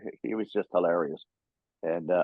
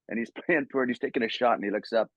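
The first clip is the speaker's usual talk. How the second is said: ordinary talk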